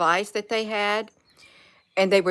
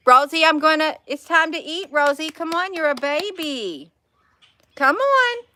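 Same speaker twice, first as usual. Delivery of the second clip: much higher, louder sing-song pet call; calling her pet; 9 semitones higher, 6 dB louder than usual. Real, not acted. Calling her pet to come eat, high loud coaxing call.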